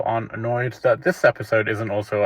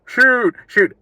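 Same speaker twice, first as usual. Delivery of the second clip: high, loud yell to the players; mock fan shout; 12 semitones higher, 5 dB louder than usual. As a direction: imitate a clueless supporter shouting from the stands. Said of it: "really bad shouts to the players".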